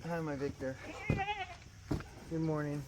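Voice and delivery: hushed hello to the goats; soft greeting. Real, not acted. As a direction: greet them very softly.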